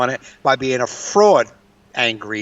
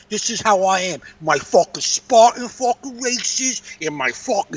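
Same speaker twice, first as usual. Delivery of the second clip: hammed-up, higher New York bragging voice; New York accent; 7 semitones higher than usual. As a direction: imitate her in exaggerated New York. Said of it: "That's how she sounds".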